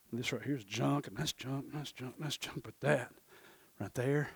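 low, very soft appraisal; understated expert. Acted. Expert sorts a customer's collectibles, low murmured verdict. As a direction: murmur it dismissively, item by item. Acts expert quietly sorting the items.

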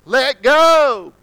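high, loud command from above; the Lord commands. Retold or imitated. The Lord tells the hanging man to let go, loud. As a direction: call down firmly: let go. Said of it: "the Lord says".

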